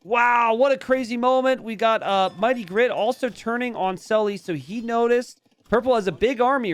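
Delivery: high, loud, breathless calling of the action; excited play-by-play. Real, not acted. Caster calling purple's chase to viewers, loud and excited. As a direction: shout the chase as it unfolds.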